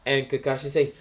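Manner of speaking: ordinary delivery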